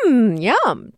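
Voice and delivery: loud, swooping savoring hum; pretend delight. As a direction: act as if you really love the food. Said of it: "act as if she really liked".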